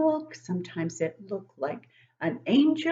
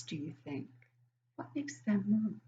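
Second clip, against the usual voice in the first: whisper; 7 dB quieter than usual. Acted. Whispering a question to the children.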